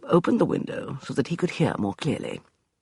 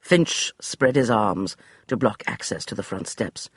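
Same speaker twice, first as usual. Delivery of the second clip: ordinary delivery, nothing marked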